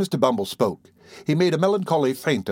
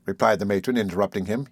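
plain reading voice

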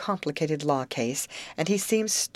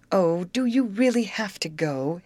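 higher, anxious plea; wife dreads him leaving, a touch of alarm; 4 semitones higher than usual. A narrator's reading. Wife asking husband not to go, alarmed. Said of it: "his wife asked in alarm".

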